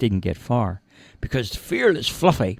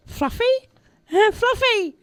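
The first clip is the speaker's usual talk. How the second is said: high squeaky call, tentative then stronger; mouse calling out. A narrator's reading. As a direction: call the cat's name in a tiny mouse voice. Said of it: "and the mouse called out".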